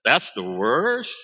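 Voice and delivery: slow, disbelieving "That's the worst?" drawn out as a question; incredulous echo. Retold or imitated. Comedian mocking the mother's complaint, slow and disbelieving. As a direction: stretch out the worst like you can't believe it. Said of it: "I wish I could do it like he did".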